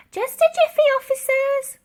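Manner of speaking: very high, loud sing-song call; old lady stalling the police at the door. A narrator's reading. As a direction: call sweetly to stall them. Dotty stalling police again.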